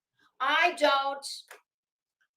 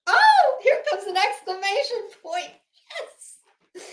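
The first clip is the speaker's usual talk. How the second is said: high, loud mock thrill; mock excitement; 8 semitones higher, 7 dB louder than usual. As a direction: gasp it like a big reveal.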